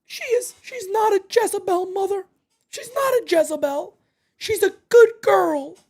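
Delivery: a hint of high, teary pleading; lightly weepy mama's-boy whine; mock crying voice. Streamer still parodying the killer, lightly teary and high. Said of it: "I couldn't get past The Voice".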